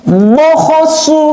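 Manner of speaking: high, crisp Quran letters; fast, firm recitation. Shown as a demonstration. Teacher shows pupils fast, firm letter reading, high and crisp. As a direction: snap out each syllable firmly. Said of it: "Harus gitu".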